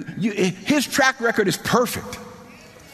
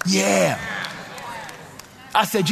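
ordinary talk, nothing marked

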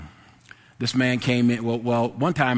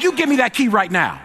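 louder, much higher-pitched command; angry retelling; 9 semitones higher, 5 dB louder than usual. Retold or imitated. Clerk ordering the man to return the key, angry demand. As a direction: act out a heated demand. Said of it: "that made me mad".

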